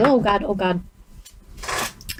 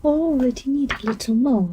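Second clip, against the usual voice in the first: ordinary talk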